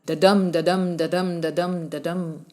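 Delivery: da-dum da-dum chanted with the second beat stressed; iambic beat. Shown as a demonstration. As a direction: tap out da-dum like a steady heartbeat. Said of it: "popularly known as da-dum".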